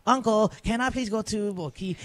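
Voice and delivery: a hint of Singaporean English; lightly put-on Singlish lilt; mock Singlish. Host showing how fast he picks up Singaporean English. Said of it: "end up".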